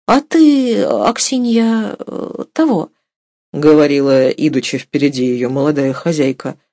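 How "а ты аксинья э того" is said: halting, higher woman's voice; hesitant. A narrator's reading. Young mistress awkwardly questions her cook, hesitantly. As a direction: feel your way into it, high and halting.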